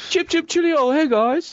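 high-pitched, hammed-up British greeting; mock British accent. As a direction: squeal it like a cartoon Brit. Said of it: "why are you talking in a British accent?".

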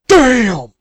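high, loud hammed-up exclamation; mock outburst. Pretend taster reacting to awful soup, loud and high. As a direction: blurt it loud after tasting. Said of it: "sip some soup and go".

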